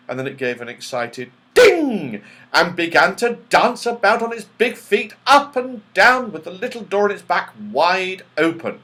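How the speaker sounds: ordinary delivery, nothing marked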